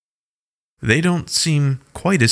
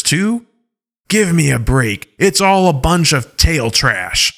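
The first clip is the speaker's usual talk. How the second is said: angry; 3 semitones higher than usual. Acted. Alpha Paw ranting at the Godflow's ways, angrily. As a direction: scoff at their lies angrily. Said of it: "in a more angry voice".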